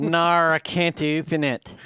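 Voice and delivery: loud, hammed-up Australian accent; broad fake Australian vowels; mock Australian accent. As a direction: imitate an Australian assistant refusing. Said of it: "a Australian voice".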